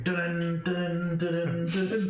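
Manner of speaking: imitating a ringing bell; bell dings. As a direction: chime each ding like a bell. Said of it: "You just hear a ding".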